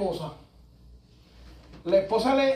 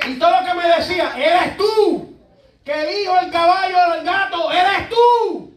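high, loud preacher's declaration; preaching at full force; 6 semitones higher, 6 dB louder than usual. Real, not acted. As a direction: nail the blame on him. Preaching climax, congregation laughs. (predicador acusando en voz alta y aguda)

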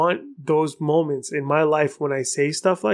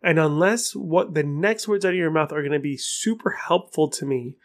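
ordinary talk

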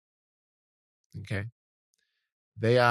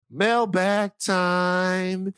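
very slow, drawn-out segment announcer; 3 semitones higher, much slower than usual. Acted. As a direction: stretch it out like a show jingle.

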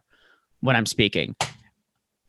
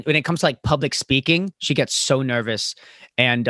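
usual speaking voice

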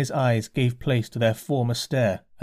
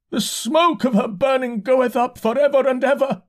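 high, loud prophetic cry; demented shout; 13 semitones higher, 4 dB louder than usual. A narrator's reading. Demented curate crying scripture at the narrator, loud and high. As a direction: proclaim it high and loud like a madman. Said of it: "he shouted".

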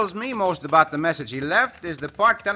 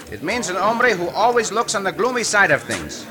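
ordinary delivery

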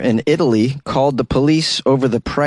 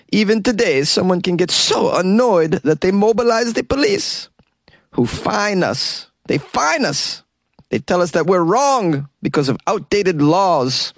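much higher, hammed-up Italian coffee bar owner, wailing; indignant Italian barista whining, high up; exaggerated Italian owner; 8 semitones higher than usual. Host voices the fined coffee bar owner, high, hammed-up indignation. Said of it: "that's him in a video".

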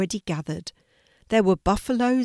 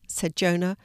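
ordinary delivery, nothing marked

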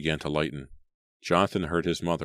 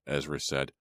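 plain reading voice

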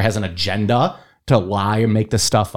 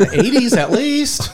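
higher, laughing through the words; laughing tease; 12 semitones higher, slower than usual. Real, not acted. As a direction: tease him while laughing. Teasing banter with laughter.

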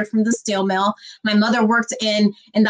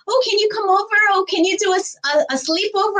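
put-on a much higher, eager kid voice asking to come over; imitating a childhood friend; 10 semitones higher, faster than usual. Childhood friend begging for a sleepover, high kid voice. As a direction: play the pleading little friend, pitched up high. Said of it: "friends that would say".

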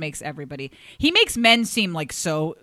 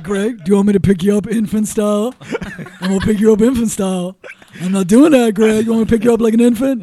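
louder, teasing Shaq voice threatening Greg; imitating Shaq's threat; 4 semitones higher, 12 dB louder than usual. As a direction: boom it out like a playful giant. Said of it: "He'd be like".

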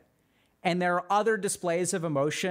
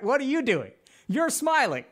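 excited; 7 semitones higher, 4 dB louder than usual. Shown as a demonstration. Excited demo of mirroring.

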